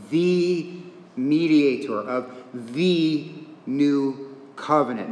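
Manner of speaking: "the" drawn out slowly and set apart, both times; stress on THE. Real, not acted. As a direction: lean on each "the" as the one and only. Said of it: "I'm emphasizing that word".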